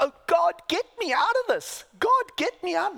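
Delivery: hammed-up plea to be rescued; mock pleading cry. A pretend Jesus begging God for escape, loud. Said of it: "No, no".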